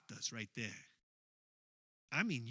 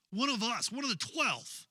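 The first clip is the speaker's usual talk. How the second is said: rising alarm; 8 semitones higher, 7 dB louder than usual. Acted. Narrator stunned by betrayal news.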